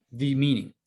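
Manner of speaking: ordinary talk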